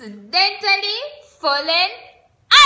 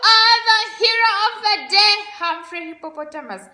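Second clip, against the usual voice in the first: cheering; 7 semitones higher, 5 dB louder, faster than usual. Acted. Friends cheer Humphrey.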